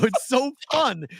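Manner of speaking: high and slow, savoring it; gushing delight. Retold or imitated. Retelling to his wife how fun it is, high and drawn out. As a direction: stretch out the joy. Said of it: "I go".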